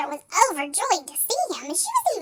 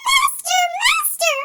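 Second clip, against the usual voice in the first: very high, loud cry for help; magic harp crying out to its master; 13 semitones higher, 6 dB louder than usual. A narrator's reading. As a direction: squeal for the master to wake. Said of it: "the harp cried".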